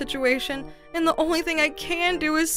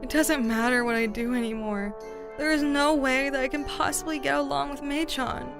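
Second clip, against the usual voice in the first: low, hopeless resignation; acted despair; 4 semitones lower than usual. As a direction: give up, voice sunk low. Giving up on the niece.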